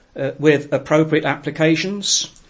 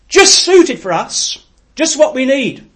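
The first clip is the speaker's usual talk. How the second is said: much higher and louder, slow and rapt; fervent; 10 semitones higher, 8 dB louder than usual. Acted. Preacher marvels at the text, high and loud. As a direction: lift it up in wonder.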